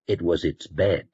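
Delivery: plain reading voice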